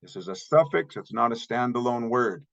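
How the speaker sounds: ordinary delivery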